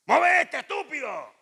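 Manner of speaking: reenacting his old screaming at employees; angry yell. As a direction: bark it at a slow worker. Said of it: "Así gritaba yo".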